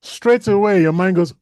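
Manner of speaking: normal talking voice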